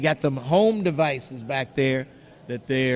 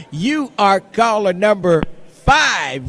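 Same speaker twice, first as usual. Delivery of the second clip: loud, drawn-out winner call; excited announcement; 4 semitones higher, 6 dB louder, slower than usual. Real, not acted. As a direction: hype up the winner. Announcing the winning caller.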